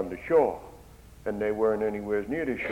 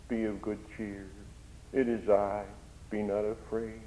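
soft, hushed comfort; whisper-close comfort to a listener; gentle reassurance; 7 dB quieter than usual. Acted. Preacher voices Jesus speaking to his hearers, soft and tender. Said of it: "have you heard his voice".

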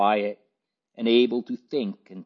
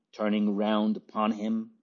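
ordinary delivery, nothing marked

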